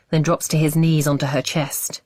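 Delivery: ordinary delivery, nothing marked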